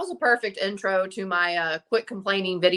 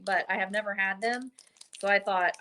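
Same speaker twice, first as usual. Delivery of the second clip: ordinary talk, nothing marked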